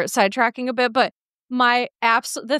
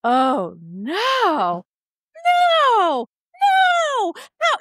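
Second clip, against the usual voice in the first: mock protest; 10 semitones higher, much slower than usual. Reacting to therapist's insight.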